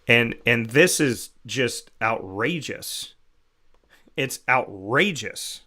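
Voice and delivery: loud, emphatic indignation; indignant outburst. Real, not acted. Host defending childhood innocence against the fundraiser, indignant. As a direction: push each phrase out forcefully, outraged.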